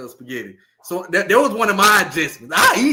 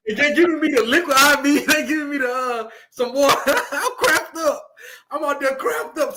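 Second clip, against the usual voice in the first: higher, giggling all the way through; tickled retelling, laughing; 8 semitones higher than usual. Real, not acted. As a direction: laugh at your own story. Laughing while retelling locker room.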